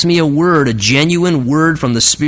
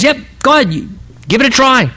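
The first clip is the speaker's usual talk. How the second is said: very high, false encouragement; totally sarcastic; 9 semitones higher, faster than usual. Acted. Prophet dares the king to try, sarcastic. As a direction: egg him on without believing a word. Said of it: "he's just being totally sarcastic".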